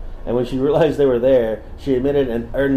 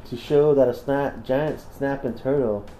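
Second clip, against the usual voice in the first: southern voice; slower than usual. Pretend. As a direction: drawl it out like a Southern storyteller. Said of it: "I'm still doing a southern".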